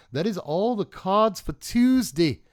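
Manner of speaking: slow, loud, high mock British announcement; fake British accent. Signing off to viewers in a fake British accent. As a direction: ham up a made-up British accent. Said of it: "British accents that aren't actually British accents".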